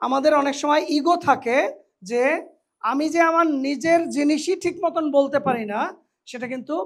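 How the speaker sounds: ordinary talk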